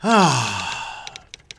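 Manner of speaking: long falling sigh; heavy sigh. Real, not acted. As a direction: let out an audible, drawn-out breath. Host pauses before the stories.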